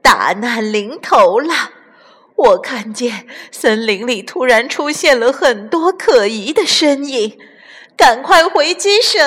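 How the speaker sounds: frightened lookout raising the alarm; panicked alarm. A narrator's reading. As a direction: build to a high, loud cry. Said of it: "惊慌地大喊".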